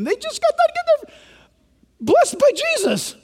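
excited incredulity; incredulous. Real, not acted. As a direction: say it in disbelief that they would argue. Amazed emphasis.

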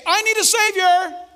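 high, loud plea; pleading sinner. Acted. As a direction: cry out for a savior. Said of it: "You'll either say".

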